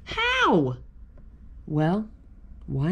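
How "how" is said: very high, exclaimed question; amazed question. A narrator's reading. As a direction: exclaim it way up high, falling at the end. Story asks in amazement.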